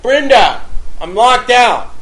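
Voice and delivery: loud, high call up to a window; calling out loudly for someone upstairs; yelling up. Retold or imitated. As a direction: yell up to be let in. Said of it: "I start to yell up to Brenda".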